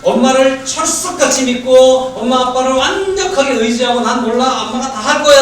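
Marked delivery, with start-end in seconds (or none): 4.0-5.4 put-on carefree child voice. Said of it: "라고 믿을수록".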